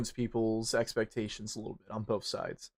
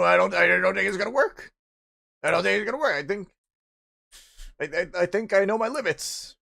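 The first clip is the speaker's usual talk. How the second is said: higher, hammed-up frightened woman voice; mock scared lady; 6 semitones higher, 5 dB louder than usual. Lady on a radio show refusing a date, mock scared and high. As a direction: play a nervous woman backing out. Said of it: "the lady was like".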